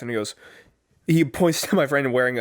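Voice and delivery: usual speaking voice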